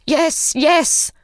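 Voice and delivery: high, panicked yes; a frightened old man giving in fast; crying out. Acted. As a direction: beg in a fright. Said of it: "cried the old man".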